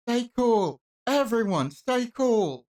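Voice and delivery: high, loud shout over the room. Acted. Ling shouts to calm the tense hall, loud. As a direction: yell "stay cool" to stop everyone.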